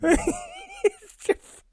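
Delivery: high, squeaky, helpless giggling speech; talking through laughter. Real, not acted. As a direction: try to talk while cracking up. Too amused to finish.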